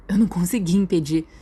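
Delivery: usual speaking voice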